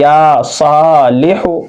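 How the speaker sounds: या सालिहु slow, या and सा held long; careful model recitation, long aa's then short लिहु; correctly stretched. Shown as a demonstration. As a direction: draw out या सा, finish लिहु quickly. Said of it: "ऐसा नहीं, बल्कि".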